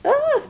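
very high short squeal of delight. Real, not acted. Finding her first crouching cat, delighted squeal. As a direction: squeak with joy at a cute toy.

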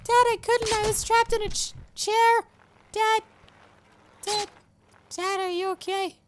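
very high put-on child voice; little boy. Son calling to his hurt dad, little boy voice. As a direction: squeak like a worried small son.

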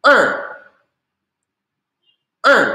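"er" short, high and sharply falling; nada empat. Shown as a demonstration. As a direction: drop "er" abruptly from a high start. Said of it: "ada empat". ("er" nada empat, pendek dan jatuh tajam)

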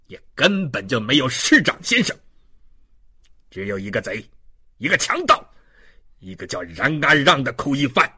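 furious contemptuous rant. Acted. Policeman denouncing the prisoner before the woman, furious. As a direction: berate them, erupting on the insults.

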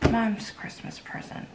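hushed secret whisper. Real, not acted. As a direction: keep it from Mom, whispered. Mom's gift, mom nearby.

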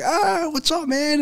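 high, thrilled call; excited greeting. Retold or imitated. Son greeting his favorite player, excited high call. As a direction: shout it up to your idol.

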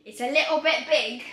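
put-on loud, playful British accent. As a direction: lean into a mock British accent. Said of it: "I don't know why I'm sounding British".

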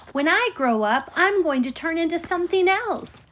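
high, loud boast; bragging. A narrator's reading. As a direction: show off your big news to a friend. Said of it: "She bragged about it to her friends".

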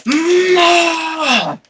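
very high, loud drawn-out scream; anguished scream. Real, not acted. Gamer losing everything, very high anguished scream. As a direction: wail it out. Loses the dog.

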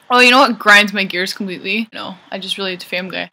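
usual speaking voice

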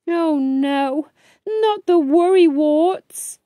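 high, anxious cry of dismay; dismayed little beaver. Acted. Brave sees his worrywarts appear, high dismayed voice.

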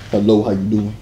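very low, smooth-talk greeting; deep voice. Shown as a demonstration. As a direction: model a deep chest voice. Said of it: "Practice, you know".

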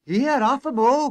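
high, loud protest; crying out. A narrator's reading. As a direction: call a halt in alarm. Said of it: "cried Shorty".

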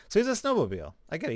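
suddenly getting the joke; amused realization. Real, not acted. Streamer spots the snowmobile pun, amused realization.

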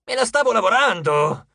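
loud, high indignant retort; indignant satyr. Acted. Coach to Jason, indignant loud retort. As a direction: defend yourself, offended.

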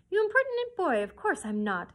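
mock-offended cry at a cheeky boy; playful protest; exclaiming. A narrator's reading. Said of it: "exclaimed Sally".

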